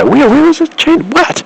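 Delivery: high, frantic, disbelieving squeak; panicked disbelief. Retold or imitated. To the passenger about her phone, a frantic high squeak. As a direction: shoot way up in panicked confusion.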